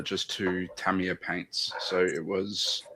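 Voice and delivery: ordinary talk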